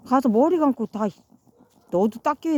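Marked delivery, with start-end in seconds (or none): none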